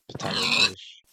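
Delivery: loud excited name drop. Real, not acted. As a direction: hype up the channel name. Excitedly names favorite YouTuber.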